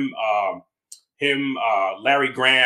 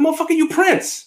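stunned recognition; 13 semitones higher, 4 dB louder than usual. Retold or imitated. Fan recognizing [name] at door.

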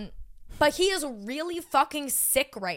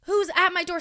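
incredulous, raised-pitch replay; retold startled reaction; 7 semitones higher, 4 dB louder than usual. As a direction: act out being startled by pounding. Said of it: "Scared the shit out of me".

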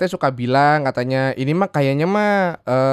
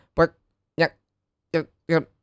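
choppy phone line; 2 semitones higher, slower than usual. Pretend. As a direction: voice cutting out like a bad signal. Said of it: "Tadi suaranya putus-putus".

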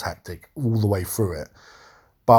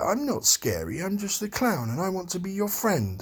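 higher, softer coaxing clown voice; imitating Pennywise sweetly reassuring the girl; sweet-talking clown; 9 semitones higher, 6 dB quieter than usual. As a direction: coo it like a creepy clown offering friendship. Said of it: "And he's like".

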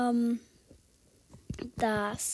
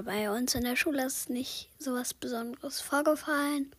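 ordinary talk

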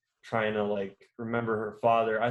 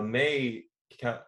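ordinary delivery

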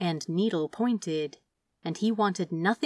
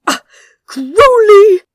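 outraged shout, much higher and louder; incensed cry; 14 semitones higher, 19 dB louder, slower than usual. Acted. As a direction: yell his name in outrage. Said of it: "cried the angel, incensed".

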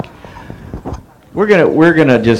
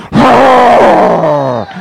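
high, drawn-out roar; lion-like crowd roar; 9 semitones higher than usual. Real, not acted. Church roaring like a lion on the count, long roar. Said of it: "we're gonna roar in this church".

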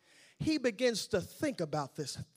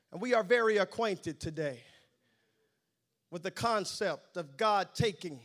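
ordinary talk, nothing marked

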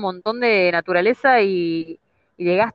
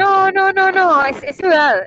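raised, higher voice over the wind; shouting over wind; 10 semitones higher, 5 dB louder, slower than usual. Real, not acted. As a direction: call out over gusting wind. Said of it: "Les pido perdón por el viento".

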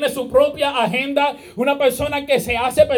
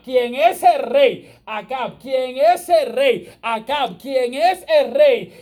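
slow and louder, stress on el rey; slow emphatic repetition; 3 dB louder, slower than usual. Acted. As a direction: hammer home "quien es el rey" slowly. Preacher stresses the king's status.

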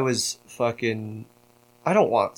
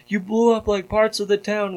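imitating outraged players, higher pitch; mock outrage in a raised pitch; retold freak-out; 8 semitones higher, 3 dB quieter than usual. Server players scolding him for blowing things up, mock freak-out. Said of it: "everybody in the server lost their fucking minds".

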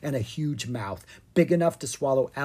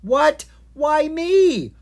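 much higher, loud whiny protest; complaining; 14 semitones higher, 9 dB louder, much slower than usual. Acted. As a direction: whine it in outrage. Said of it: "Owen complained".

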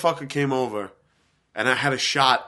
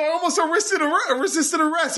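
very high, incredulous protest; retold exasperated relief. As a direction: tell the prankster what nearly happened. Retold shock after prank revealed.

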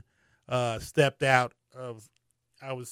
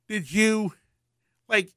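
usual speaking voice

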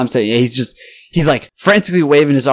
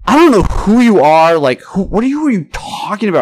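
retold high-pitched bafflement; exasperated; 9 semitones higher, 5 dB louder than usual. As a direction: protest loudly at the start, then stay high and baffled. Retells his exasperated comeback.

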